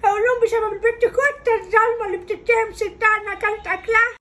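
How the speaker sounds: high, loud mock-tearful lament; milk the guilt trip like a tragedy; wailing grandma. Grandmother wails dramatically. (جدة بتولول بتمثيل بكاء)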